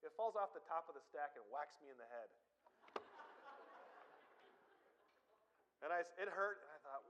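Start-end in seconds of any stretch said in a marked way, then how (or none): 2.7-4.2 airy, unvoiced high laugh at himself; a soundless wheeze of a laugh; breathless silent laugh. Real, not acted. Laughing at his own mishap.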